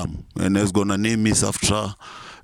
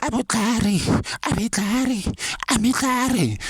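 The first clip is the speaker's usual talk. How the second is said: much higher, falsetto mock chant of [name]; imitating [name]. Mocking the rapper [name]'s new song in falsetto. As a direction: squeak it out high, mocking the rapper. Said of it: "He will say".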